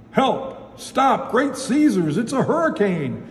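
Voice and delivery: high-pitched storybook panic; alarmed cry. A narrator's reading. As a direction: cry out for help, pitched well up. Grocer calls out in alarm.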